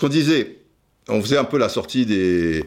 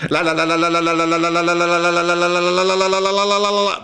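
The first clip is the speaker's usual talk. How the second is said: rapid-fire syllables; 5 semitones higher, faster than usual. Shown as a demonstration. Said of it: "Peut-être, euh, là, tu vois".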